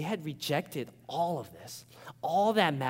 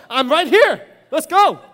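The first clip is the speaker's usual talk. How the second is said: much louder and higher mock challenge; challenging shout; 11 semitones higher, 14 dB louder than usual. Pastor acting out his loud comeback to Sonia. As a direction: bark the dare back, hammed up. Retold squaring up to fiancee.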